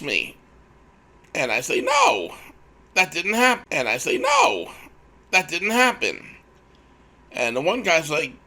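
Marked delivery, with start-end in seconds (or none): 1.8-3.7 high, loud mock-outraged "no"; emphatic retold denial. Employee denying a threat to two HR men, high emphatic shout. Retelling his denial of an accusation. 4.2-6.4 high, loud mock-outraged "no"; emphatic retold denial. Employee denying a threat to two HR men, high emphatic shout. Retelling his denial of an accusation.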